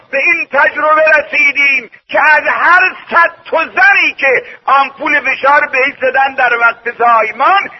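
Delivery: forceful, emphatic sermon delivery; impassioned preaching. Acted. Preacher warns congregation about childbirth injections, forceful preaching.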